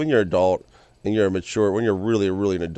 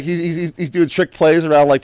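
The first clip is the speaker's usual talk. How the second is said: usual speaking voice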